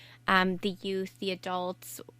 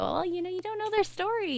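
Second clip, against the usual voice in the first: gentle inner reminder; 11 semitones higher than usual. Retold or imitated. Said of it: "to look at them and go".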